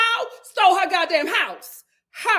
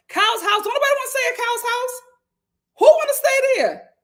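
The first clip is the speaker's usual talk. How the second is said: higher, fast and mocking; incredulous; 9 semitones higher, 5 dB louder, faster than usual. Real, not acted. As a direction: scoff at the very idea. Mocking disbelief at Kyle's house.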